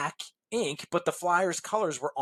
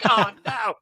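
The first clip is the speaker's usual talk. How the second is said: "Oh, no." laughed out, higher and louder; embarrassed laugh; 5 semitones higher, 7 dB louder than usual. Real, not acted. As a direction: laugh at your own blunder. Realizing the gaffe.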